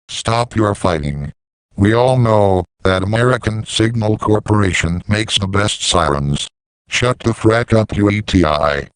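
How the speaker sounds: usual speaking voice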